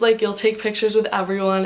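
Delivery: usual speaking voice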